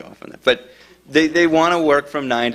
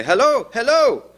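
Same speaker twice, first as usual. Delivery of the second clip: high, drawn-out urgent hellos; third caller; 12 semitones higher than usual. Retold or imitated. Third guy grabs the phone, imitated desperation. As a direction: call desperately into the phone, pitched up. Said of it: "And now a third guy grabs me".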